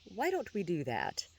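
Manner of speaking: lightly ironic agreement after a short laugh. A narrator's reading. Johann agreeing to go to Chaz's office, ironic. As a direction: agree with a touch of irony. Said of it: "ironic tone".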